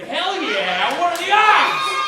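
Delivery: put-on high, loud drunken whoop; excited shout. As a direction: yell it like a thrilled drunk kid. Drunk younger self shouting excitedly.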